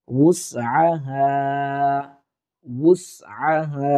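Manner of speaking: উসআহা spoken slowly, each sound given its full length; slow drill. Shown as a demonstration. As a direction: spell out উসআহা slowly for learners. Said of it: "drilled slowly as demo". (উসআহা ধীরে ধীরে অনুশীলন)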